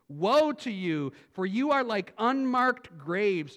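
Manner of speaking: loud, slow woe; slow, loud prophetic warning; stern rebuke. Acted. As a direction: thunder the woe. Reading Jesus' rebuke.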